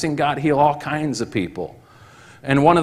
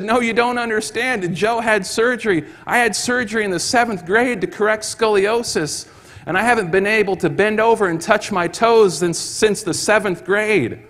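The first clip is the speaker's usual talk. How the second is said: amazed; 7 semitones higher than usual. Retold or imitated. Both men explaining, amazed.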